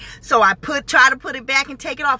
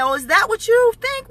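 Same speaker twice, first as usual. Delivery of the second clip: much higher, challenging question; retold sassy comeback, pitched high; mock-surprised retort; 9 semitones higher than usual. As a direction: toss it back in mock disbelief.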